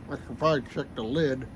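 talking around pipe stem; pipe in mouth. Real, not acted. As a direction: lips clenched on pipe. Seen 0: Pipe stem clenched in mouth throughout, smoke visible while speaking.

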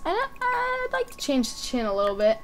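usual speaking voice

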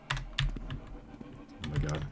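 slow breathy whisper. Real, not acted. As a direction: sigh it out under your breath. Overwhelmed, breathes it out.

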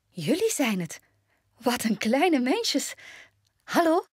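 high-pitched, sweet astonishment; surprised. A narrator's reading. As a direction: marvel at them, then greet them brightly. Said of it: "keek de dwergen verbaasd aan".

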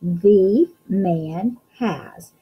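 very slow, word by word; slow reading aloud. Shown as a demonstration. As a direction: stretch out each word as you read. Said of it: "Let's read again".

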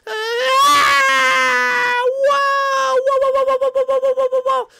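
long, high, loud yell into rapid whoas; hammed-up stuttering scream of terror; rollercoaster scream. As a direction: scream through the drop. Screaming on the pretend coaster.

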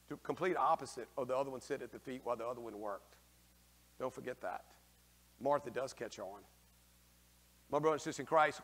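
ordinary delivery

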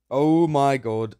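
slow, loud oh my god; drawn-out amazed gasp of words; loud, slow disbelief; stunned. Real, not acted. Shocked at soul reward.

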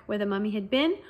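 ordinary delivery, nothing marked

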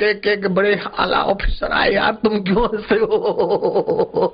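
high voice breaking into giggles; laughing while talking. Acted. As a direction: officials who can't stop laughing. Officials questioning each other, all laughing.

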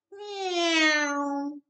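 high, bright little cat meow; a small bear playing cat, meowing high; kitten meow. A narrator's reading. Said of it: "went Baby Bear".

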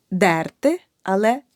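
ordinary talk

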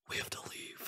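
unvoiced urgent whisper; mock-panicked whisper. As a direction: hiss it to your friend in panic. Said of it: "I looked at Sarah and I said".

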